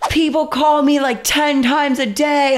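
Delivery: high, loud imitation of an angry yell; mock screaming neighbor. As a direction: shriek it like the furious neighbor. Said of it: "comes out of his fucking apartment screaming at me".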